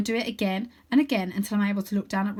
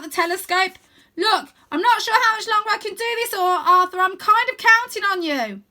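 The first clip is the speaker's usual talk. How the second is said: high, loud frightened pleading; anxious sister; fearful; 11 semitones higher, 7 dB louder than usual. A narrator's reading. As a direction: hide the fear behind nagging. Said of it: "I hear the fear in her voice".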